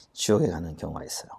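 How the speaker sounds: 숨소리로만 속삭이듯; 안타까운 사실을 낮게 속삭여 전하듯; 속삭임. Real, not acted. 안타까움 속 속삭임. (속삭이는 목소리로)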